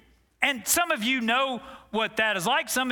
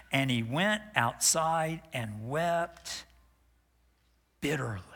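slow, soft and sinking, fading to a whisper; low, hushed grief; sorrowful; 6 semitones lower, 4 dB quieter than usual. Acted. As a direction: let it fall away in grief. Sorrowful reading of weeping.

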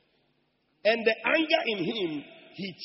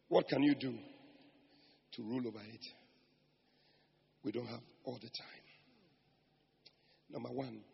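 low, soft, with long pauses; hushed preaching; 5 semitones lower, 8 dB quieter than usual. Real, not acted. Preacher counselling congregation on anger, hushed and low. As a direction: bring the sermon right down, quiet and low.

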